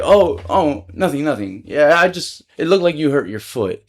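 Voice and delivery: hammed-up phony politeness; super fake. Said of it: "like, super fake, super".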